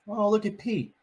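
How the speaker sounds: ordinary delivery, nothing marked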